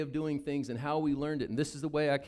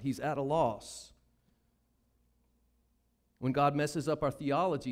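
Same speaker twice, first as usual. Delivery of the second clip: ordinary delivery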